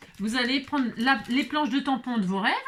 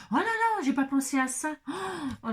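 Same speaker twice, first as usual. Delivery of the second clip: surprised; 7 semitones higher than usual. Real, not acted. Realizes no electricity.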